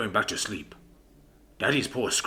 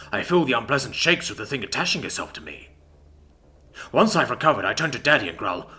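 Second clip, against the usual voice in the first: ordinary delivery, nothing marked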